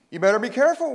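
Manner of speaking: high, loud storybook warning. A narrator's reading. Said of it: "warned Jack".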